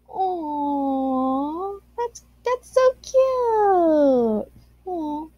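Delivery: high, slow cooing squeal over cute fan art; cutesy baby talk. Acted. Gushing at cute fan art of David, high slow baby-voice coo. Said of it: "[name], why are you talking like this?".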